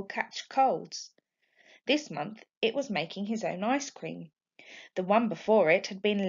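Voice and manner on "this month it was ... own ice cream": usual speaking voice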